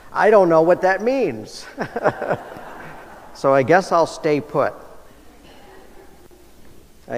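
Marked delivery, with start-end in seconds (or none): 0.1-2.4 says it through a real laugh, a little higher; amused, can't hold back a chuckle; laughing at his own confusion. Real, not acted.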